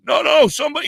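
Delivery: high, loud protest; desperate shout. Retold or imitated. Trapped driver yelling at bystanders, high and desperate. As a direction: cry out against them. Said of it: "And I'm like".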